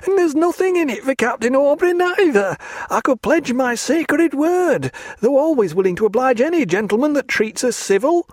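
a frightened lad begging rescue; tearful clerk. A narrator's reading. Clerk pleads to Stephen as to a saviour. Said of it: "wiped his tears".

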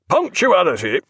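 loud, sharp-tongued naval captain; stern captain scolding. A narrator's reading. Captain scolds his late friend on deck, loud and stern. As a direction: dress down the late doctor.

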